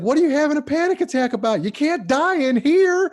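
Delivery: very high, loud squeaky war-vet teacher; high-pitched teacher voice. Retold or imitated. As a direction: screech it like the shrill teacher. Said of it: "he had a real high-pitched voice".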